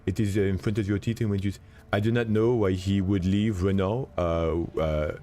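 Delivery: French accent, forward in the mouth. Shown as a demonstration. Showing how French talk about Renault, forward French accent. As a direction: speak at the front teeth with a French accent. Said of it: "you talk very forward in the mouth".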